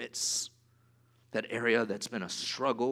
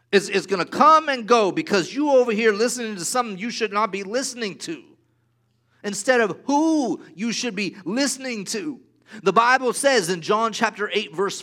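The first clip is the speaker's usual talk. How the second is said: louder, higher, driving sermon voice; fervent preaching; 8 semitones higher, 9 dB louder than usual. Acted. Pastor preaching a warning to the congregation. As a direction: press the warning hard on the church.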